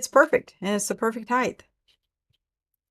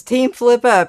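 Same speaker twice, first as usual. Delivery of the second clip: excited cheer; 4 semitones higher, 10 dB louder, slower than usual. Retold or imitated. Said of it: "Lewis says".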